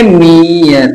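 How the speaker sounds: मीयर drawn out as 'meee-yar'; corrected pronunciation. Shown as a demonstration. Teacher repeats the right reading for students, lengthened long-ee मीयर. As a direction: stretch the long ee of मीयर, then yar. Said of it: "क्या पढ़ेंगे".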